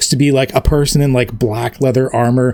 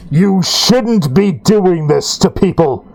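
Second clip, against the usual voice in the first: lightly voiced scolding knight; 3 semitones higher, slower than usual.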